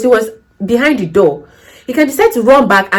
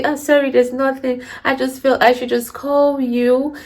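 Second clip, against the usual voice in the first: put-on soft, apologetic old resident, a bit higher; timid elderly resident; 4 semitones higher, 5 dB quieter than usual. Elderly resident sheepishly tells the carer they just called, soft and apologetic. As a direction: play a shy care home resident who rang for nothing. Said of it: "they tell you".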